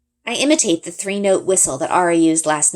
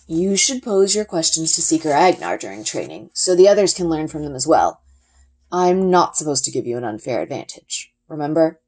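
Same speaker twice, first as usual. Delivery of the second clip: a lightly voiced, steady father explaining; a hint of a calm, knowing father; Papa's voice. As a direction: answer patiently like a wise dad. Papa gently refusing to help.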